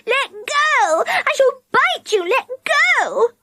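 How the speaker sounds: very high, squealing protest; panicked screaming. A narrator's reading. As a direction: squeal to be released. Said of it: "She screamed".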